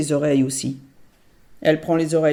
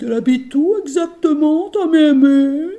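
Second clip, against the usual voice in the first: higher, louder, slow coaxing; sweet-talking ogress; 10 semitones higher, 8 dB louder than usual. Acted. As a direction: coax the answer out of her. L'ogresse questionne mielleusement. (voix d'ogresse faussement douce)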